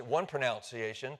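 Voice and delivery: "pronunciation" said with NOUN in the second syllable; old NOUN variant. Shown as a demonstration. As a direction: say "pronunciation" as pro-NOUN-ciation. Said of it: "would retain the pronunciation".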